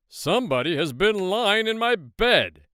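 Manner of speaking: big gruff bear, loud and forceful; gruff storybook bear bellowing a complaint; Papa Bear. A narrator's reading. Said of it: "said Papa Bear in his big".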